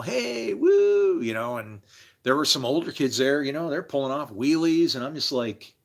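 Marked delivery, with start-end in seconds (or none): 0.0-1.2 excited whoop. Retold or imitated. Kid cheering in hallway.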